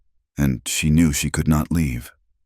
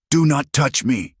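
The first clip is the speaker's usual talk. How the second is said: narrated hiss; 10 semitones higher than usual. A narrator's reading. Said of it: "he hissed".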